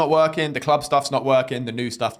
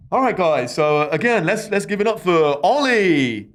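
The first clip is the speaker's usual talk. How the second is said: compere introduction; 3 semitones higher, 3 dB louder than usual. Retold or imitated. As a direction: announce the act like a club host. Host introducing comedian on stage.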